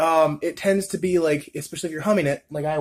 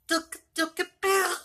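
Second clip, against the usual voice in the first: tiny squeaky-high voice, breaking off; higher-pitched voice; 4 dB quieter, slower than usual. Shown as a demonstration. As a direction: attempt a very high voice, failing. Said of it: "I can't do the voice".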